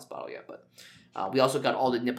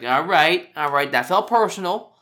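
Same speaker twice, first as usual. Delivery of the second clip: higher, louder mock indignation; raised-voice pretend affront; mock offended; 5 semitones higher, 8 dB louder than usual. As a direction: play at taking it personally. Acting out reaction to being peed on.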